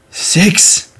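high, strained eerie voice, word by word; spooky sleep-talker blurting lone words; creepy sleep talk. Retold or imitated. Faking sleep talk to freak out Avery, creepy high voice. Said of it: "saying all this creepy shit".

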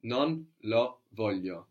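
non lo voglio, each word apart and unhurried; slow, word by word. Shown as a demonstration. Teacher models the answer slowly, word by word. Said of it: "think it through slowly like this".

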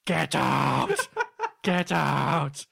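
loud, harsh shouting in a strange voice; mock possessed shout. Possessed Derek orders the ghost hunters out, shouting. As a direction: bellow like a spirit driving people out. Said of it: "he'd be like".

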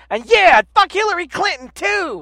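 loud, high hype-man shout; hype friend cheering. Retold or imitated. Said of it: "and he's like".

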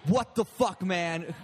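mock-angry, high and loud; retold angry outburst. Drunk subway rider confronts the laughing storyteller, retold angrily. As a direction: act out a stranger's indignant challenge.

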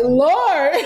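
laughing exasperation. Retold or imitated. Exasperated aside to UPS guy.

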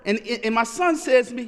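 ordinary talk

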